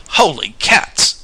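high, loud, drawn-out exclamation; startled exclamation. A narrator's reading. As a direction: stretch it out in loud astonishment. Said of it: "exclaimed a man's voice".

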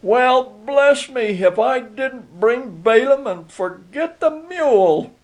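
high-pitched, drawn-out outburst of realisation; sudden exclamation at one's own blunder; bursting out. A narrator's reading. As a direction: let it burst out high and astonished. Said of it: "it burst from his lips".

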